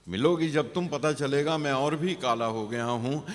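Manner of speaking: poem recitation. Acted. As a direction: recite it out in one unbroken line.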